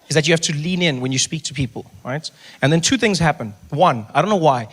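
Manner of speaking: normal talking voice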